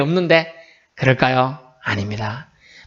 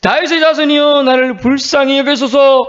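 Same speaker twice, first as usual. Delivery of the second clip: shouting even louder; 9 semitones higher, 4 dB louder than usual. Acted. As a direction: cry out at full pitch, stretching the plea. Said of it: "그가 더욱 크게 소리질러". (더욱 크게, 높고 길게 부르짖으며)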